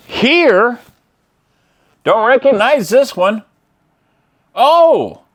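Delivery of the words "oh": sudden high "oh" of surprise; surprised. Real, not acted. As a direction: caught off guard, exclaim. Spots a laser disc.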